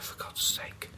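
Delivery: retold under-the-breath exasperation; exasperated whisper. Manager says you can't go, exasperated whisper. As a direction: whisper it as a private complaint.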